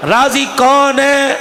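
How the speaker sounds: ordinary delivery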